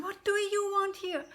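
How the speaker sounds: high, loud demand; gruff giantess. Acted. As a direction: challenge the intruder. Giantess challenges Jack.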